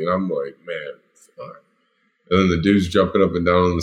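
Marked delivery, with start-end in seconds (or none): 0.7-1.6 soft, defeated curse under breath; muttered curse. Retold or imitated. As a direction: let it drop out quietly. Resigned under threat.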